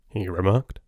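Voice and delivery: plain reading voice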